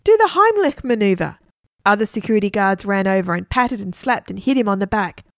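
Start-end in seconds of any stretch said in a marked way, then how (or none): 0.0-1.4 high, urgent call; shouted. A narrator's reading. Woman telling onlookers to save a choker, shouting. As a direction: call the instruction out much higher. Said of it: "shouted a woman".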